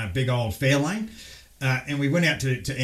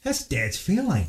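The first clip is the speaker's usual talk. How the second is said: put-on higher, slow astonished child; a six-year-old realising it's dad's car; slow wide-eyed realisation; surprised kid; 5 semitones higher, slower than usual. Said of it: "I'm like".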